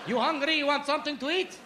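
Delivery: put-on high, excited Arabic-accented offers. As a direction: press food on a guest in an Arabic accent. Cousin turns eager host in accent.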